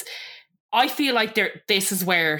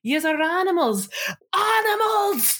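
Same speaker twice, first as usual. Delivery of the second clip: high-pitched imitated outburst, climbing on the repeat; mock outraged yell; 12 semitones higher than usual. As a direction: draw out the last word in a higher mock yell. Acting out Jason's angry outburst.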